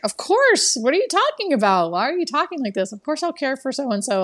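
high-pitched, baffled reassurance; retold incredulous protest. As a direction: imitate a caregiver protesting in disbelief. Caregiver's puzzled protest, voiced in story.

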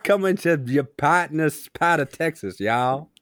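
slow mock Texan drawl; slow, shaky attempt at Texan; Texan accent. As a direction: put on a botched Texan accent. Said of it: "go Texas".

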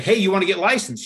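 spring the offer on them, high and loud; upbeat pitch. Retold or imitated. Brother phones sister out of the blue, upbeat pitch.

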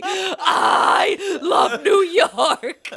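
very slow, loud, laughing ironic cheer; sarcastic shout. Acted. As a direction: belt it out like a bitter slogan. Running sarcastic catchphrase.

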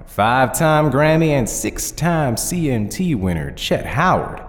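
lightly voiced courteous zombie cowboy; polite Southern cowboy. Narrator voices Nail, the zombie contestant.

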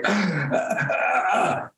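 short, faked winded cries; pretend breathlessness. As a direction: fake being unable to breathe. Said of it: "pretended I couldn't breathe".

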